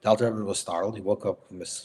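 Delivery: ordinary talk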